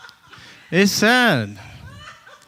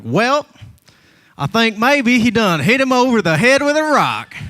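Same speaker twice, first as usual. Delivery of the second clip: hammed-up Hollywood Southern accent, pitched up; thick Southern accent; 6 semitones higher than usual. TV investigator in Atlanta talks to cops in a mock thick Southern accent. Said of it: "they always have a thick Southern accent".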